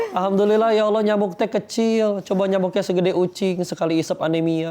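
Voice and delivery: high, loud, amazed praise; excited thankfulness. Acted. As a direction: marvel out loud at a blessing. Voices gratitude about the mosquito.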